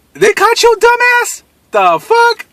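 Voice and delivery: high, slow mocking taunt; mocking disbelief. Mocking the handcuffed robber, high slow taunt. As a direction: taunt him with big disbelief.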